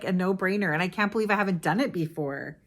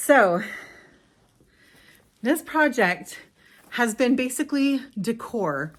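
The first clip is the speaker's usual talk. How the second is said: out of breath; 4 semitones higher, 3 dB louder than usual. Real, not acted. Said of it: "I'm a little out of breath".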